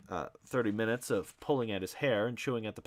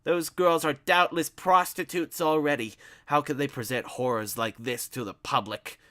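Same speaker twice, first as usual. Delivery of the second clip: outraged Ignatius; 6 semitones higher, 8 dB louder than usual. Acted.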